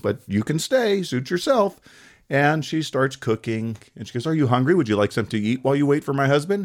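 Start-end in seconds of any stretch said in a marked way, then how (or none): 0.0-1.8 high, airy wife voice; lightly mocking woman, pitched way up; amused wife. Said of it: "So she just laughed". 4.2-6.6 higher, quick wife voice; innocently polite offer in a woman's voice; sweet hostess. Acted. Wife slyly hosting the giant.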